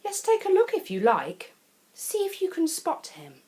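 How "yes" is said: ordinary delivery, nothing marked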